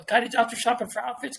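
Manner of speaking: usual speaking voice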